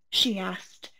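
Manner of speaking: ordinary delivery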